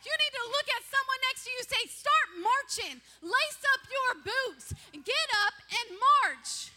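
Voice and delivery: high, loud preaching that builds to shouting; full-force preaching. Acted. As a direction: preach it hard and rousing.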